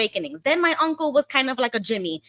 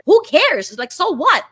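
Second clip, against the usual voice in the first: higher, louder, brushing it off; uncle's dismissive voice; 8 semitones higher, 6 dB louder than usual. Retold or imitated. Imitating the uncle brushing off her fears, louder and higher. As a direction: toss it away like the pushy uncle.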